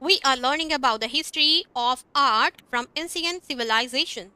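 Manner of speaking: slow, careful example sentences; slow teacher model sentences; slow demo reading. Shown as a demonstration. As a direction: read it out slowly for the class. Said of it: "हम इस तरह से बोलेंगे".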